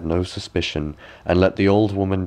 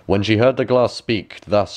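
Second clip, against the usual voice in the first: ordinary delivery, nothing marked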